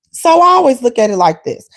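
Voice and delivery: usual speaking voice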